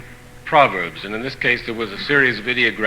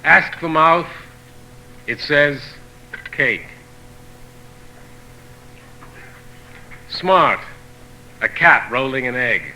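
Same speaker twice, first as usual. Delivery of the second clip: poetry reading; 3 dB quieter, slower than usual. Acted. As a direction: read each saying in separate phrases, pausing between them. Reading of proverbs continues.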